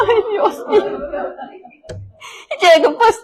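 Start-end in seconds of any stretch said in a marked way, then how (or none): 0.1-1.0 high, laughed-out mock dismay; amused retold exasperation; laughing exasperation. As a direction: exclaim it through a laugh, pitched way up. Laughing at husband's retold excuse.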